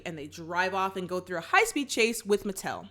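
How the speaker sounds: ordinary delivery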